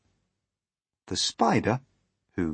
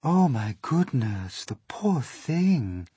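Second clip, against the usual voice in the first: slow, higher cry of pity; concerned ladybird; 6 semitones higher, 4 dB louder, slower than usual. Acted. Ladybird pitying frightened James, slow concerned cry. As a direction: draw it out with high, swooping sympathy. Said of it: "the ladybird cried".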